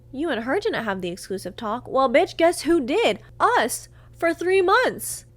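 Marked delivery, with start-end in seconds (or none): 1.9-5.2 loud, high, drawn-out comeback; indignant retort. Retold or imitated. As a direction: hit each phrase slowly and indignantly. Indignant retort about the exclusive talk.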